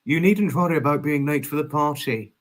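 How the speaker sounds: ordinary delivery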